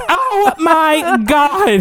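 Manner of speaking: high, slow, breathy-free shocked "Oh my God"; drawn-out stunned exclamation, way up high; shocked gasp. Retold or imitated. Shocked reaction in retold bar fight.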